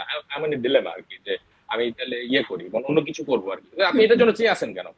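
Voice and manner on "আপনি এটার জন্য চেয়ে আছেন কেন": mock annoyed. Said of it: "উনি বিরক্ত হয়েছে".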